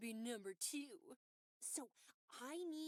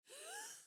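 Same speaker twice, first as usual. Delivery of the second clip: gasp. Acted. Shocked gasp before insult.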